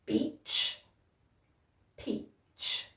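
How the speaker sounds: peach, beach, peach mouthed very softly, lips pressed hard on the P; exaggerated P and B. Shown as a demonstration. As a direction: overdo the lip shape, almost voiceless. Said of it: "repeat after me".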